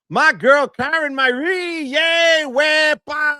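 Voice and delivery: high, loud, drawn-out greeting; excited shout-out. Real, not acted. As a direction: call her name out with joy. Greeting a fan on live.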